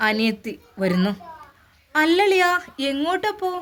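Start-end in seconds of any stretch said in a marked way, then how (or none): 1.9-3.5 put-on higher, lively girl's voice; a cheeky younger sister calling out; young sister-in-law's voice. Younger sister asks her brother-in-law where he is going, higher girl's voice.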